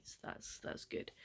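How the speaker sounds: barely voiced, dropping away; very quiet. Real, not acted. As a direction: let it fade almost to nothing. Sleepy bedtime sign-off.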